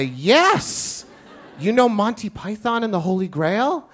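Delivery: high, loud excited yes; teacher delighted a shy girl knows the film; thrilled. Retold or imitated. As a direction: burst with delight at the question.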